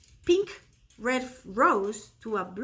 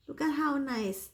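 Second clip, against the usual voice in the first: ordinary talk, nothing marked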